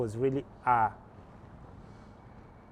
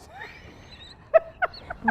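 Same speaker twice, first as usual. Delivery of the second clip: real burst of laughter; laughing; 4 dB louder than usual. Real, not acted. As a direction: laugh out loud at the remark. Laughs at harsh summary.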